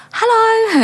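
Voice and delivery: singsong reply, pitched far up; retold innocent greeting; acted bright greeting. She answers the dealer's call.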